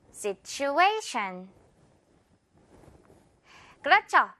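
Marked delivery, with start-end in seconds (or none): 0.1-1.5 Situation, A punched, very slow; stress on A. Shown as a demonstration. Teacher modelling for students to repeat, slow stressed A. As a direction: model it for repetition. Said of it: "듣고 따라해주세요. 큰 소리로".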